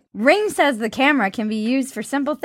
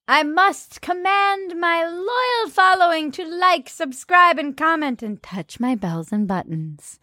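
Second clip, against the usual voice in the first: mock grand YouTuber; 6 semitones higher than usual. Mock YouTuber call to subscribe.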